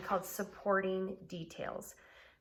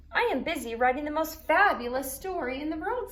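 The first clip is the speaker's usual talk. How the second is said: Unicorn's boast; 7 semitones higher, 7 dB louder than usual. A narrator's reading. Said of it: "said Unicorn".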